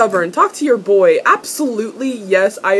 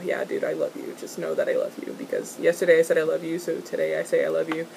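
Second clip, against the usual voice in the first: mock lovey whisper; 6 semitones lower, 10 dB quieter, faster than usual. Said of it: "he wants Magnus to know".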